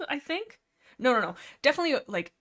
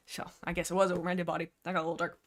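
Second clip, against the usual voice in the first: normal talking voice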